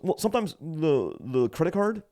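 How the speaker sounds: high, hesitant imitation of a clerk; explaining clerk. Clerk explaining mismatched card numbers, mock clerk. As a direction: mimic a clerk explaining, voice lifted.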